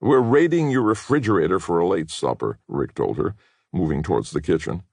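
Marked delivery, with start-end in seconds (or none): 0.0-2.6 sardonic reply. Acted. Rick answering Katie sarcastically.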